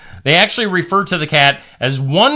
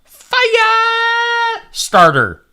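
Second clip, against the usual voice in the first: dramatic shout; much slower than usual. Pretend. Dramatic mock announcement.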